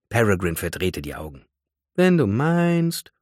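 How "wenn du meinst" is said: high, drawn-out, doubtful concession; grudging murmur. A narrator's reading. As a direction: give in slowly, unconvinced. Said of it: "murmelte sie". (widerwillig gemurmelt, gedehnt)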